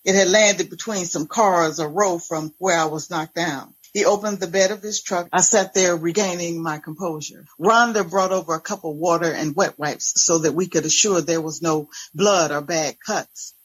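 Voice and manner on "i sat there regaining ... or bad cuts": plain reading voice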